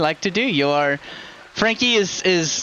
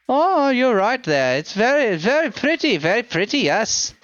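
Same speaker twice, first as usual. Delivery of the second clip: admiring elf blacksmith; 5 semitones higher than usual. Acted. Elf smith examines a gem, admiring lilt. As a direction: coo over it with rising and falling pitch.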